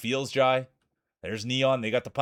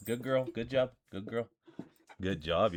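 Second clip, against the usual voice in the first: soft puppy praise; 2 semitones lower, 8 dB quieter than usual. Real, not acted.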